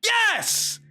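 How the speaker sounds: high, elated yelling; excited shout. Real, not acted. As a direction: celebrate at the top of your voice. Celebrating the win.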